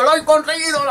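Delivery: high, elated winner cry; high joyful triumph; triumphant winner. Acted. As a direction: crow your victory. Winner triumphant after fight.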